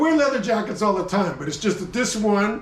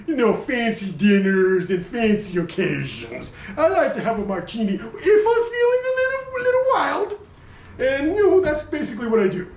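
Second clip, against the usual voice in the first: higher, prissy posh voice; a fancy jacket that likes martinis; snooty upper-class jacket; 4 semitones higher than usual. Pretend. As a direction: play an upper-class snob at a dinner party.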